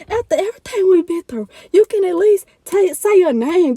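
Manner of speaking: pleading demand. Retold or imitated. To the ex on the phone, a high pressing demand. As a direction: push hard for the name, voice climbing.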